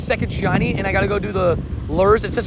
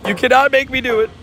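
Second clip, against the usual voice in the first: put-on high-pitched whiny baby voice; hammed-up teary whimper; mock crying whine; 6 semitones higher than usual. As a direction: whine like a kid about to cry. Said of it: "I'm gonna cry".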